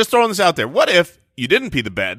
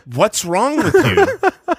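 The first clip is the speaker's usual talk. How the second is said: annoyed mom; 5 semitones higher, much slower than usual. Retold or imitated. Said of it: "And then she said".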